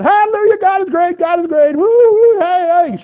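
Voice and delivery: high, loud jubilant shouts; hammed-up church celebration; celebrating. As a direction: whoop it up like a happy congregation. Congregation dancing around celebrating.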